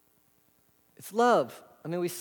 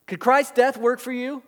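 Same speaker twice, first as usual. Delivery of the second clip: usual speaking voice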